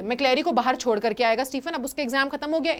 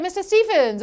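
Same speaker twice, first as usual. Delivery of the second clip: retold shout; 5 semitones higher, 3 dB louder than usual. Officer calling Stephens across the prison, mild shout. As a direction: call the name out, higher and louder. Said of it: "वो जोर से चिल्लाता है".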